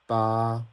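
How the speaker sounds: "Ba" drawn out smooth and level; first tone. Shown as a demonstration. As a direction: hold "Ba" on one flat pitch, dragging it a little. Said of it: "you wanna drag it a little bit".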